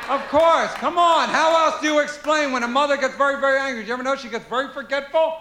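usual speaking voice

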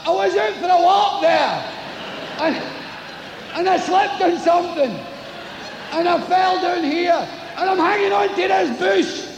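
high, strained explaining from the cliff; stranded man pleading with God. Acted.

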